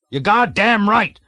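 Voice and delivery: loud, high bellow; roared. A narrator's reading. Colonel roars at the trembling cadet Clevenger. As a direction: roar it at the cowering cadet. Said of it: "roared the colonel".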